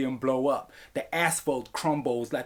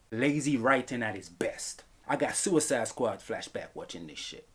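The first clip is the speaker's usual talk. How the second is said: ordinary talk, nothing marked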